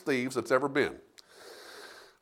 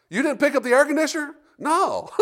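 incredulous; 11 semitones higher than usual. Retold or imitated. Disbelieving phone call retold.

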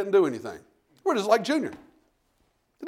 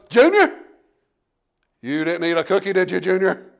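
mom catching son; 5 dB louder than usual. Retold or imitated. Mom catching Junior with the cookie, sharp call. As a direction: catch the boy in the act. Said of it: "Mom jumps in there and goes".

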